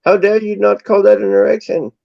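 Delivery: hammed-up indignant German patient. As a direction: play the insulted patient huffing. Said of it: "the German guy's like".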